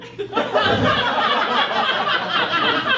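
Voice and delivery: high giggle rising up; light high laugh; audience-style laugh. Real, not acted. Laugh after toddler quote.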